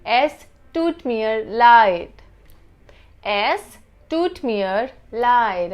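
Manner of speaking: "Es tut mir leid" said slowly, each word apart; slow demo. Shown as a demonstration. Teacher slowing down the German apology for students. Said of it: "how to say I'm sorry in German".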